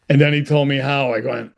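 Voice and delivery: usual speaking voice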